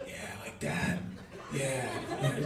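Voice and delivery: susurro ronco; breathy bedroom murmur. Retold or imitated. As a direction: murmurar sin voz. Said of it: "You're like".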